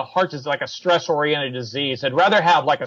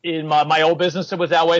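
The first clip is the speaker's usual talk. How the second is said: usual speaking voice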